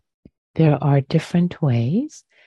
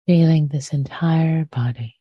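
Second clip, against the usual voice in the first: guided meditation. Acted. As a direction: ease listeners gently into the body.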